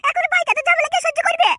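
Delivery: angry wife. Acted. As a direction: nag the husband about his friend. Wife voiced in skit, complaining.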